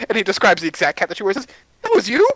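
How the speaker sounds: suspicious question. Acted. Magician questioning the druid about the fish. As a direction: press her on it. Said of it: "he looks at you and goes".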